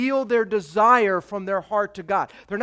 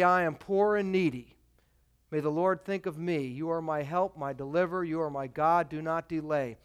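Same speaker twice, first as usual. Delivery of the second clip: softer, lower humble plea; a hushed, lower petition; humble prayer; 4 semitones lower, 6 dB quieter than usual. Acted. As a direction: pray it quietly, as one who is needy.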